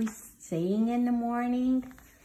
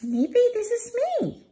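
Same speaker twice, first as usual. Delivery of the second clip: much higher, quick delighted aside; excited; 6 dB louder than usual. Acted. Teacher realizing the book is about her, delighted. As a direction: squeal it out, delighted.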